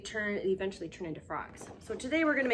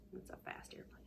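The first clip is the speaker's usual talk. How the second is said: whisper; 15 dB quieter, faster than usual. Pretend. Whispered hint.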